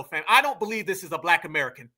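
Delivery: ordinary talk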